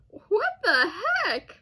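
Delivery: normal talking voice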